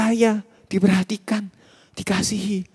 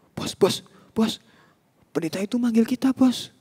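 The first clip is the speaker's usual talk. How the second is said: nervous gang lackey; 5 semitones higher than usual. Acted. Gang member warning the boss that the pastor is calling. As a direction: creep up to the boss and tug his sleeve.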